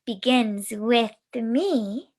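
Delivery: slow, calm chant; slow calm affirmation. Shown as a demonstration. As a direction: draw each word out. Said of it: "the affirmation".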